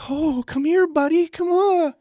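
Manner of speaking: high, loud, drawn-out coddling; mock fussing. Relative fussing over the fallen nephew, mock fussing. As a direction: gush over a hurt child in falsetto. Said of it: "festered over him".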